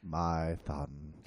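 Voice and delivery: low, solemn fatherly voice; deep Jor-El voice. Pretend. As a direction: intone it like a space father.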